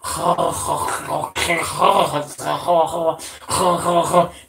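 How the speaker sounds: harsh throaty gargle of fake talk; mock someone with wordless, rasping throat noises; long guttural mumble, hacking and wheezy; imitated garbled talk. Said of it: "That talks like this".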